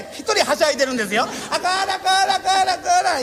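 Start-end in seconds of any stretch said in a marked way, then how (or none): 1.5-3.2 tipsy master. Retold or imitated. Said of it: "言いながらやるんですが".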